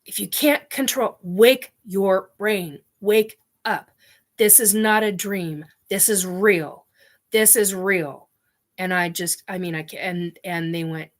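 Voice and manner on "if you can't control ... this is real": raised, high urgent plea, easing toward the end; retold screaming. Screaming in her head at captive humans to wake up, retold yell. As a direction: re-enact a desperate yell, punching each command. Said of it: "just start screaming".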